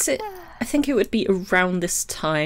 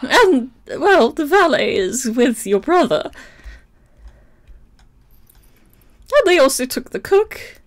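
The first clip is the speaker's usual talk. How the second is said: put-on old housekeeper voice; 10 semitones higher, 6 dB louder than usual.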